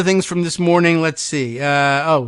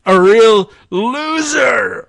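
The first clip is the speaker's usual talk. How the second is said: slow, loud, higher mock insult; all-caps shout; 5 semitones higher, 6 dB louder, slower than usual. Host reading Trump's attack post, mock loud shout. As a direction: yell out loser like a rally taunt. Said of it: "all caps".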